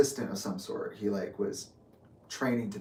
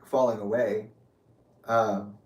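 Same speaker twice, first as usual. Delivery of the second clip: ordinary delivery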